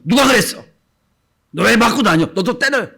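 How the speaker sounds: high, loud furious scolding; angry parent. Retold or imitated. Parent yells at a child who came home beaten. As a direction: shout at the bruised child. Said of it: "이렇게 하십니까?".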